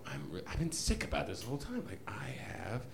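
hushed, breathy whisper of a secret; whispered confession. Retold or imitated. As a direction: whisper it so nobody else hears. Confessing secret girlfriend to Kate.

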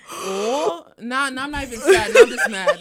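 high, loud, laughing retort; laughing mock retort. Retelling a laughing retort to her parents, high and loud. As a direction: toss it off, laughing.

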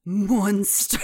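laughing through a Canadian "Monster"; mock Canadian monster. Imitating the Canadian line with her husband, laughing. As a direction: tease each other with the Canadian line. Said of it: "turn to each other and be like".

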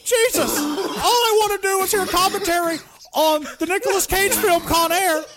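over-the-top pretend tirade breaking into laughs; mock rant. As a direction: fake fury at the giggling cohosts.

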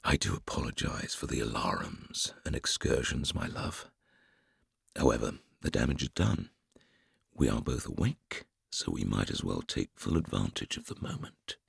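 soft, unvoiced whisper. A narrator's reading. Hector whispering an apology to Joe in bed. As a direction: whisper it close to her ear. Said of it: "he whispered to her".

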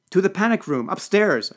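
loud, high emergency command; urgent order. A narrator's reading. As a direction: call out orders amid panic. Urgent order as rioters break in.

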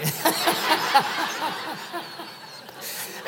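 high breathless wheeze of laughter; airy giggling cackle; laughing. Real, not acted. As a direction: laugh silently at your own joke. Laughing at his own joke.

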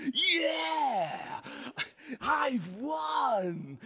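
high, drawn-out victory cry; mock triumphant yell; gloating little sister. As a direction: crow it high and long, milking the win. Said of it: "like this".

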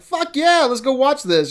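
excited fan. Retold or imitated. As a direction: cheer it like a pumped-up fan. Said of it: "everyone's that's listening is like".

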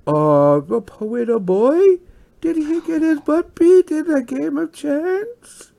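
high, slow, cooing mockery; mocking baby talk. Lottie teasing the beaten boy, mock baby talk. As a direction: coo as if pitying a baby. Lottie mocks the losing gambler.

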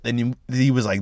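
ordinary talk, nothing marked

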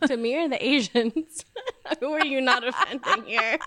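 laughing. Real, not acted. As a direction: laugh through the question. Laughing while teasing.

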